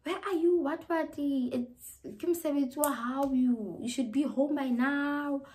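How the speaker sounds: high, loud, nagging relatives demanding answers; mock the frantic family calls, loud and high; nagging callers. Family calling non-stop, demanding loudly where she is. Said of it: "they are calling non-stop".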